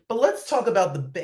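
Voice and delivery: usual speaking voice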